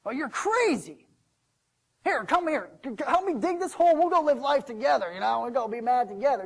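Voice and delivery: high, insistent, worked-up plea; loud urging. Acted. Servant urges the son to help him dig, loudly. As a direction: recruit him loudly and eagerly.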